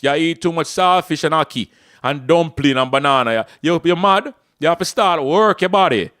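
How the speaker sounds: put-on loud, rough country Jamaican accent. Coach voicing his rough Jamaican viewer, loud Jamaican accent. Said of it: "my Jamaican brother wanna come in too".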